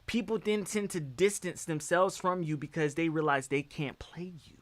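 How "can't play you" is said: a sly aside in a breath; whispered. Real, not acted. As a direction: whisper it, no voice at all. Drops voice for emphasis.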